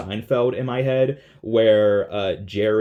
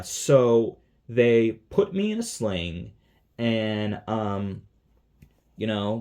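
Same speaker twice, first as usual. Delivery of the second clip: ordinary talk, nothing marked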